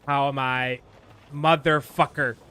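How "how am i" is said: ordinary talk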